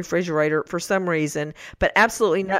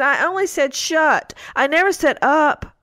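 put-on teary little girl; 7 semitones higher than usual. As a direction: draw it out like a kid on the verge of tears. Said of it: "And she said".

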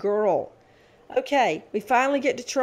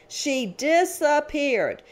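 DISAPPEARED drawn out syllable by syllable, high; slow emphasis; 4 semitones higher, much slower than usual. Real, not acted. Prosecutor recounting her child victim vanishing mid-trial, slow emphasis. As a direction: stretch out "disappeared" slowly for dramatic weight. Repeats line for dramatic effect.

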